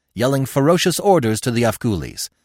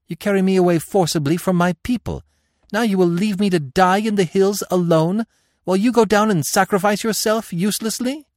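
higher, reproachful princess voice; Devi; 9 semitones higher than usual. Acted. Devi accusing Conan of leaving her to die, higher reproachful voice. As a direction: plead and accuse him at once, pitched up.

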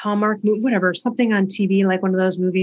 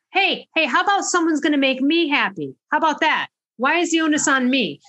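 higher-pitched indignant challenge; indignant objection; 6 semitones higher than usual. Retold or imitated. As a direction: push back at the movie line, pitched up. Retells objecting to the movie line.

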